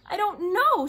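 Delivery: high, loud choked sob; girl bursts into tears before the soldier; choked crying. A narrator's reading. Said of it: "her voice choked".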